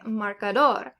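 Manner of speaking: marcador stressed on DOR, marcaDOR; stress on last syllable. Shown as a demonstration. Teacher showing learners final stress, marcaDOR. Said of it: "stress on the very last syllable".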